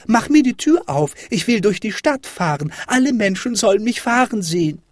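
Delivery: put-on high, insistent child's yelling, loudest at the start; high-pitched, bossy clamour; demanding shout. A narrator's reading. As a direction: cry out orders like a spoilt little boy. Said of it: "schrie Häwelmann".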